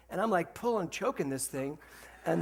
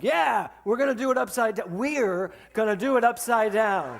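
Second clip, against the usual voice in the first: raised, keen, slowing on the repeat; eager insistence; 5 semitones higher, 8 dB louder than usual. Retold or imitated. Husband urging her to zipline upside down, raised eager voice. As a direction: push the idea with glee.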